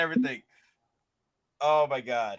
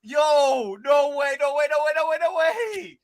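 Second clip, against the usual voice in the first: excited disbelief; 7 semitones higher, slower than usual. Real, not acted. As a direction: shout it in giddy disbelief. Reacting to surprise announcement.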